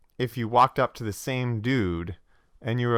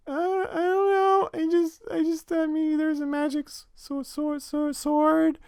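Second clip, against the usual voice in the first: very high, stammering squeak; absurdly high, hesitant caricature; player character's voice; 3 dB louder, slower than usual. Acted. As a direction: play a timid, tongue-tied adventurer in a tiny high voice. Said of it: "this is your character's voice".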